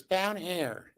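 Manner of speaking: tiny high-pitched call; little man's voice. Retold or imitated. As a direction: pipe up from way down there. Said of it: "The voice goes".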